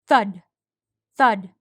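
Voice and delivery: booming thud sound effect; loud thud effect. A narrator's reading. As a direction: boom each thud out.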